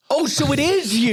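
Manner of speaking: high-pitched mock surprise; retold surprise, pitched well up; surprised realisation. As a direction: act out suddenly recognising someone. Retelling his surprised realisation.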